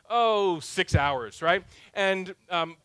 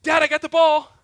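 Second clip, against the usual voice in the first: put-on louder, higher, eager little boy showing off the ball; excited kid; 8 semitones higher, 10 dB louder than usual. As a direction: bounce in like a kid holding up the ball. Son returns with the ball.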